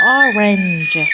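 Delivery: اورنج drawn out, ending in an English "j"; example word. Shown as a demonstration. Teacher gives students an example, drawn-out pronunciation demo. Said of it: "قادر يجي هذا الحرف كيما".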